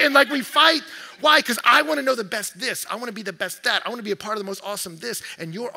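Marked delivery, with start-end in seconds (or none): 0.0-1.4 loud, higher shout on fight. Real, not acted. Pastor crying out about believers fighting, full shout. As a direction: belt it out like the point is obvious.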